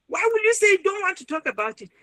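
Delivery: high, fast indignant outburst; screaming person. Retold or imitated. Visitor screaming at the registrar, high and fast. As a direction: fire it back as an outraged visitor. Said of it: "the person will be screaming".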